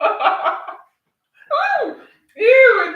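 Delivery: high laughing yuck; disgusted. Real, not acted. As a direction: squeal at the bad taste. Tastes ginger on the spoon.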